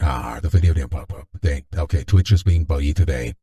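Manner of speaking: usual speaking voice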